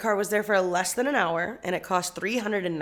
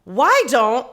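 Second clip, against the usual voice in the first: loud, higher acted-out demand; exasperated; 5 semitones higher, 10 dB louder than usual. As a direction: spell it out to someone obtuse. Retold demand to landlord.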